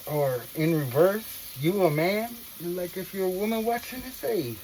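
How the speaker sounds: usual speaking voice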